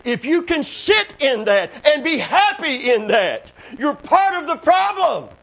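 loud, high, emphatic revival preaching; fiery pulpit delivery with held phrases; full-force preaching. Real, not acted. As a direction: proclaim it to the congregation, punching key words.

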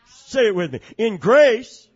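loud, high, ringing preacher's cry; full-force preaching. Acted. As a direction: preach it out to the whole church.

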